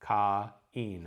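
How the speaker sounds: "Cain" broken apart as KA, EEN; cut vowels. Shown as a demonstration. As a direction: sound each vowel of "Cain" as its own syllable. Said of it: "I'm gonna cut these".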